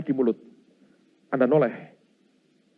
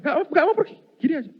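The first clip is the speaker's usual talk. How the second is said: incredulous; faster than usual. Real, not acted. Ghost refuses to leave.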